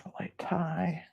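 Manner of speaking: breathy whisper to self. Real, not acted. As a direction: whisper it while working. Whispering while tying the wrap.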